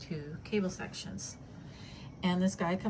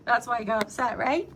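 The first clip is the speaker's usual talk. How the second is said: laughing while talking; 4 semitones higher, 7 dB louder than usual. Real, not acted. Voicing Dex, laughing.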